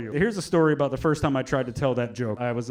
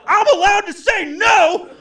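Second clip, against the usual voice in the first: high, loud yell breaking into laughs; comic yelling at the crowd, laughing; yelling through laughter; 6 dB louder than usual. Retold or imitated. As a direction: shout it while cracking up. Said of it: "Now I'm yelling at him".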